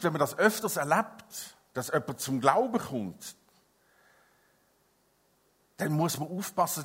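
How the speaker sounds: normal talking voice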